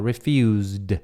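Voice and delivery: Refused ending in z then d. Shown as a demonstration. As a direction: land the z and d at the end of Refused. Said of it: "Tem som de Z e D no final".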